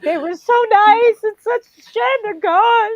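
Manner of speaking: high, loud fake-tearful wail; mock sobbing. Funeral guest pretending to mourn the dead, mock sobbing. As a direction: bawl it out in pretend grief. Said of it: "I might be able to cry at a funeral".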